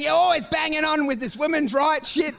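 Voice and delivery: hammed-up drunk yelling; mock shout. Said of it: "I was saying".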